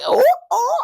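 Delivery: put-on high, loud childlike oohs; kids' scandalized oohing. Niece and nephew reacting to the kiss, scandalized. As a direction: squeal it like kids catching a kiss.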